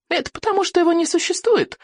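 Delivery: put-on high, thin boyish insistence; stubborn boy. A narrator's reading. Boy sticking to his claim, high stubborn insistence. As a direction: keep pressing in a high little voice. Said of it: "гнул своё Икинг".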